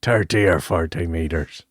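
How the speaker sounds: low, exaggerated Irish brogue; Liam Neeson. Host echoing the river line, low Irish brogue. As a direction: lay on a deep Irish accent. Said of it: "with, like, a hint of brogue".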